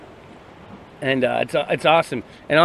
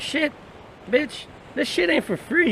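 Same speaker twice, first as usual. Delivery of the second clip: squeaky voice pitched way up; mock high squeal; 11 semitones higher, 4 dB quieter than usual. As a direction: squeal it out like a cartoon hustler. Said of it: "I'm like".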